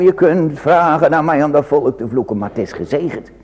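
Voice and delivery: ordinary talk, nothing marked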